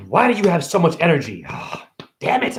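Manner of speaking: ordinary talk, nothing marked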